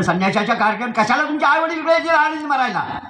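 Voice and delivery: high-pitched, hostile rhetorical question; acted bullying retort; scornful villain's retort. Villain scorns the children's parents.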